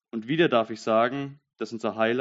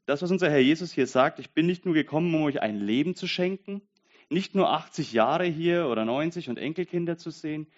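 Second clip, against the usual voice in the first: preaching at full force; 6 semitones higher than usual. Acted. Preacher to congregation, quoting Jesus, fervent preaching. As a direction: proclaim it to the back row.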